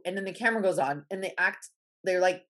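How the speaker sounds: ordinary delivery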